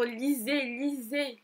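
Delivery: ordinary talk, nothing marked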